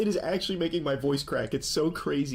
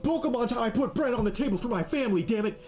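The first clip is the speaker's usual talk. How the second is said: hammed-up indignant rant, higher and fast; mock angry streamer rant; 5 semitones higher than usual. Commentator mocks the card seller ranting at a buyer, hammed-up anger. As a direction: ham up a petty seller's fury.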